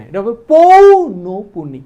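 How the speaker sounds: loud, slow, stressed পৌনপুনি; teacher firmly correcting a common mistake; emphatic correction. Shown as a demonstration. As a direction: hammer home the right form, slowly. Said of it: "না, উল্টা করে ফেলবি এখানে".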